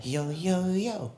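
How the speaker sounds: soft mock hip-hop chant; lightly voiced girlfriend rapping along; girlfriend's yo yo. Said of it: "she goes".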